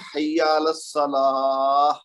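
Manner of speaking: "حی علی الصلاح" with long final laa into h; call-to-prayer wording. Shown as a demonstration. Teacher quotes the azan, drawn-out final laah. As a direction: stretch the last syllable of "حی علی الصلاح". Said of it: "اذان سنتے ہیں".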